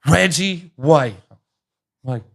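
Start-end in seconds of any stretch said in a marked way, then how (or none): 0.1-1.1 higher, louder, slow drawn-out name; star-struck announcement. Retold or imitated. Mom telling her son who is at the toll, drawn-out and excited. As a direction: stretch the name out in disbelief.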